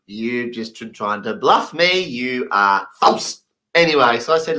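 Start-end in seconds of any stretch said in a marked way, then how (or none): 0.1-3.4 slow deliberate accusation; slow accusing officer. Retold or imitated. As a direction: spell it out slowly, accusing. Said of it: "She's going".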